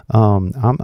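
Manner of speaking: normal talking voice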